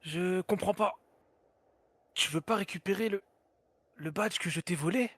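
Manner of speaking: anime dub. Acted. Dubbed Gon confused.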